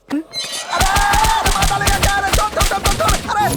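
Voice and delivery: high, repeated cries to let go; frantic begging under a beating; panicked pleading. Acted. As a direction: yelp as the blows land. Beaten, begging to be released.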